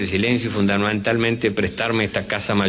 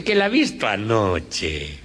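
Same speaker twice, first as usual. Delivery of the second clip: slow, lower, weary Galician reply; slow Galician accent, patient refusal; 3 semitones lower, slower than usual. Acted. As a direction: drag it out tiredly, Galician style. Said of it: "este cuento de gallegos".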